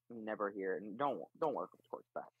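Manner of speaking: usual speaking voice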